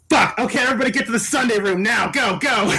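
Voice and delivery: high-pitched, mock-panicked command; urgent order. Friend herding sick wedding guests out, urgent mock panic.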